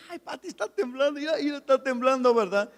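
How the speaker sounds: bubbling over with glee at the quake; telling wife Pati eagerly the house is shaking; excited. Retold or imitated. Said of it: "¿Pero por qué se emociona tanto?".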